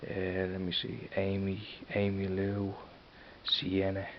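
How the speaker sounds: normal talking voice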